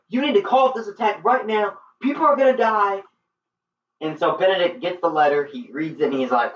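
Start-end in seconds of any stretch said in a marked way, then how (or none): 0.1-3.0 high, pleading mock alarm; imitated desperate warning, pitched up; urgent warning. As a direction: act out an urgent plea to a commander.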